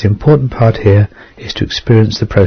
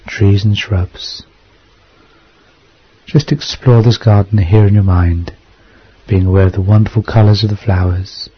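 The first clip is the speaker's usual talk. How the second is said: guided meditation. Acted. Leads a hypnosis meditation.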